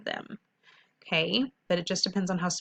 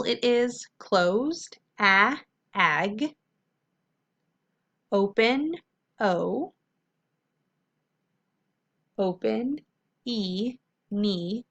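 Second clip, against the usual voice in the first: slow teacher demo; much slower than usual. Shown as a demonstration. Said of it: "let's look at our first syllable".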